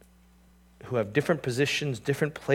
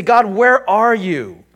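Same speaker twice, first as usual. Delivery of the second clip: lost plea; 8 semitones higher, 11 dB louder, slower than usual. Retold or imitated. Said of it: "they say".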